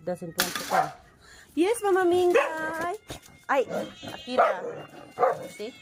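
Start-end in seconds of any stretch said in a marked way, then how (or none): 1.5-5.7 high cooing pet voice. Real, not acted. Owner answering her cat Mingay in high cooing baby talk. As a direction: croon the cat's name up high.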